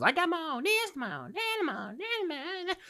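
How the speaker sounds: high mock voice listing possessions; singsong it like a smug list; mocking women listing. Mocking women listing what they own, high singsong. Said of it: "how women like to go down that fucking list of".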